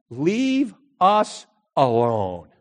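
very slow curt dismissal; cold dismissal. Retold or imitated. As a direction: shut him out for good. Lady's final dismissal.

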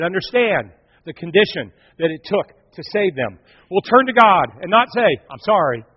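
loud, rising preacher's plea; preaching at full force. Acted. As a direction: preach it out loud, pleading for repentance. Preacher builds to loud pleading.

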